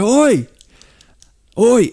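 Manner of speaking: loud high yell of "Oi!"; calling out. Retold or imitated. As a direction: bellow across the traffic. Man keeps calling out.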